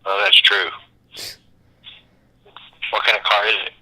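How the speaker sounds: usual speaking voice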